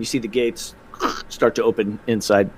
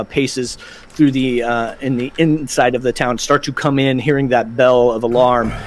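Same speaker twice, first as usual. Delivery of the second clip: ordinary delivery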